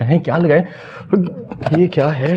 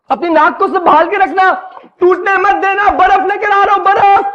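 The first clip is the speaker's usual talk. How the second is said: higher, loud, hurried calling; loud panicked shouts to the bride; frantic calling out; 8 semitones higher, 5 dB louder than usual. Acted. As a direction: yell it while running back with ice. Groom calling out rushing with ice.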